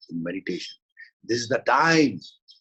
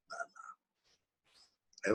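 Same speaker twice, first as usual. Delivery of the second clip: very slow whisper; 11 dB quieter, much slower than usual. Acted. Teacher whispering the name of Allah, reverent. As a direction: let the name fall to a whisper.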